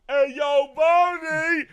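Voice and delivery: high, loud, slow ballpark announcer; announcer call. Pretend. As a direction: call the name like a stadium announcer. Repeats the announcer name call.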